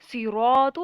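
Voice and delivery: Quran recitation. Shown as a demonstration. Tajweed teacher recites Quran word.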